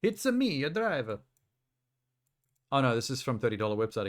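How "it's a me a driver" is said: high, bouncy Mario voice. Pretend. Streamer repeating the joke, high Mario-style Italian. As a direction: pop up into a cartoon plumber. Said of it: "Italian Mario stereotype".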